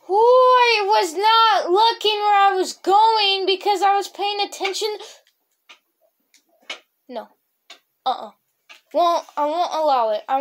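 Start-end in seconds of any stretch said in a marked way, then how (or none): 0.1-5.0 high, loud startled cry; startled yelp. Real, not acted. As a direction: yelp in real fright at the sudden danger. Startled while playing.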